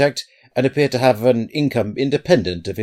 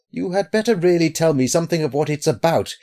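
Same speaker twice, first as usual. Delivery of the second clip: higher-pitched, uneasy urging; mildly anxious appeal; slightly afraid; 8 semitones higher, 3 dB louder than usual. A narrator's reading. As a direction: press him with a touch of fear. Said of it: "himself slightly affected by fear".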